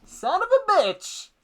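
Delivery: a high, slow yell from outside; high drawn-out offstage curse; shouted curse. Acted. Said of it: "you hear".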